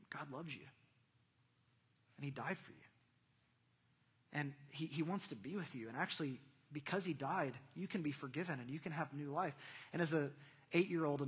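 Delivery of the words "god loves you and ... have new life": hushed, kindly telling with pauses; gentle Sunday school teacher. Acted. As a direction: speak softly and gently, as to a little child. Sunday school teacher speaking to child.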